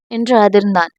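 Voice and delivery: ordinary delivery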